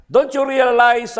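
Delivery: high, loud devil threat; Satan threatening. Acted. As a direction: loom over him as the devil. Satan threatens old man.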